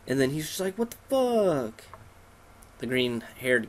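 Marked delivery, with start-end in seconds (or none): 0.8-1.8 high, drawn-out incredulous cry; hammed-up shock; mock disbelief. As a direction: stretch it out high like a stunned loser. Voicing stunned defeated rival.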